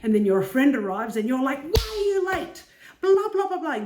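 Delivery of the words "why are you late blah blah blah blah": much higher and louder accusing shout; angry outburst. Retold or imitated. To a friend who arrived late, an accusing shout.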